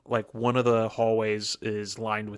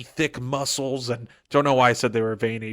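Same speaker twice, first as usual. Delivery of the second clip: ordinary talk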